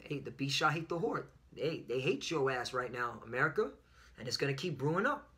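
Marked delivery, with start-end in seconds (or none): none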